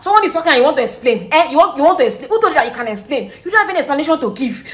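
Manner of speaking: fast, high, loud outraged mother; angry mom scolding. Retold or imitated. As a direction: berate her like a furious mom, fast.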